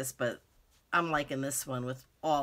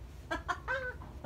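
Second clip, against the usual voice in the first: high flustered giggle; a quick embarrassed laugh; 6 dB quieter than usual. Real, not acted. Flustered over wrong paper.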